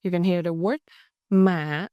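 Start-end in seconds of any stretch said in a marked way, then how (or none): 1.3-1.9 "mạ" said as mả, dipping down; "mạ" in the dipping hỏi tone. Shown as a demonstration. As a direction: dip the pitch through "mạ". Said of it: "is dipping".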